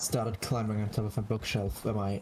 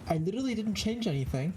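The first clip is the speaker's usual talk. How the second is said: tinny, hollow sound, pitched higher; like talking through a can; 10 semitones higher, faster than usual. Real, not acted. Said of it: "You sound like you're talking in a can".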